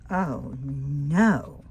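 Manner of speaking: ordinary delivery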